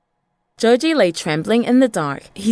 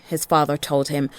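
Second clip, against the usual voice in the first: ordinary delivery, nothing marked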